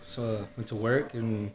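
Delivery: speaking away from the mic; too far from the microphone. Real, not acted. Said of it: "talk directly into the mic".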